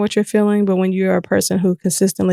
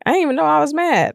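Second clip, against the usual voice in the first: mock surprised realization; 8 semitones higher, faster than usual. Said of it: "And I was like".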